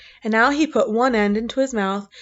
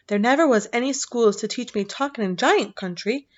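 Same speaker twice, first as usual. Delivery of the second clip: plain reading voice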